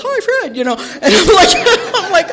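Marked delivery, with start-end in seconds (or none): 0.0-0.6 high, chorused "Hi, Fred" as a mild mock yell; mock group yell. Whole meeting answering Fred, a mild high mock yell. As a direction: echo the whole room calling back. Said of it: "Everybody yells".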